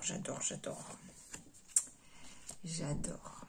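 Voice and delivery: breathy whisper of delight. Real, not acted. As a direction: breathe it out, charmed. Whispered delight over stamps. (murmure ravi)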